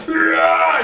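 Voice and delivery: big high roaring shout; Thor's roar. Acted. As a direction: roar out in a rage. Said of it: "He throws something else".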